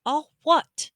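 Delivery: put-on young Harry asking. A narrator's reading. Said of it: "Harry asked".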